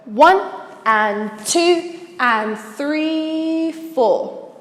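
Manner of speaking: very slow, stretched count; counting out beats. Shown as a demonstration. Dance teacher giving the next four counts, drawn-out counting. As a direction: hold "three" long, then drop on "four". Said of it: "the next four counts will go".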